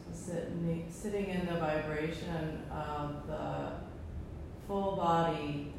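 soft, calm breathing guidance; guided meditation. Acted. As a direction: ease them into stillness. Closing meditation after om chanting.

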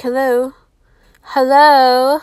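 loud, rising call of hello; incredulous loud hello. Real, not acted. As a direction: shout hello at the audience as if nobody is listening. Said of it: "calling out to viewers".

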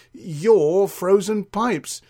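slow, pointed emphasis on "your", hinting; meaningful stress. Acted. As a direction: lean slowly and heavily on "your". Hints at the friend's stomach.

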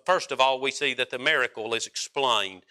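ordinary talk, nothing marked

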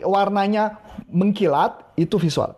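ordinary talk

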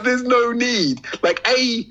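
ordinary delivery

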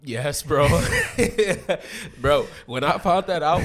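high, loud laughing burst; laughing excitedly. Real, not acted.

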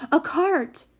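high, sudden call; excited shout. A narrator's reading. Prudence spotting a lift at last, shouting. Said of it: "shouted Prudence".

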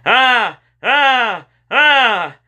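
high, loud, sustained yells; yelled "ah". A narrator's reading. Slime-covered boy racing toward his friend, yelling. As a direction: yell out in long high cries.

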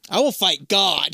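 very slow, loud heroic vow; epic vow. Pretend. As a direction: declare it like a hero.

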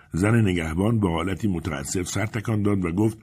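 ordinary delivery

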